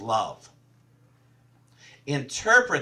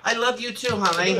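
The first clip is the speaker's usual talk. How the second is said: laughing quote; 8 semitones higher, 5 dB louder than usual. Retold or imitated. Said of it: "he says to me".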